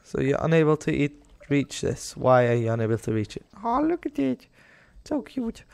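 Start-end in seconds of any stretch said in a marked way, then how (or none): 3.6-5.6 cooing at a cute animal. Real, not acted.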